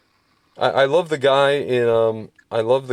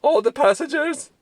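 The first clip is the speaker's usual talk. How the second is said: put-on German accent; 10 semitones higher, 4 dB louder than usual. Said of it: "he's like".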